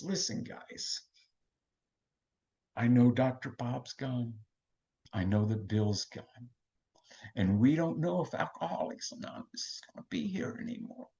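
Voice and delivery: calm the room with a hush; hushed old lady. Retold or imitated. Elderly lady hushes the fighting room.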